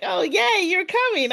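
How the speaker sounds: high, happy cheering welcome; delighted welcome. Retold or imitated. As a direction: greet her like a friend you missed. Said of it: "they were just like".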